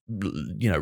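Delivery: ordinary talk, nothing marked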